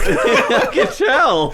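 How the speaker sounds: high, loud laugh through the words, slow. Real, not acted. Guest agreeing with a friend while howling with laughter. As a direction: squeeze it out mid-laugh.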